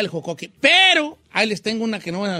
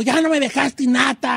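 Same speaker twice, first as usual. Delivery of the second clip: raised, higher-pitched family protest; mock complaint; 4 semitones higher than usual. Someone at home protesting the missing nata, mock indignant. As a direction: act out an indignant relative's complaint. Acts out family fighting over cream.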